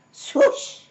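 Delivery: high whooshing splash noise; swoosh. A narrator's reading. Narrator making the sound of milk spilling. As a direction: hiss out the spill. Milk pours over face.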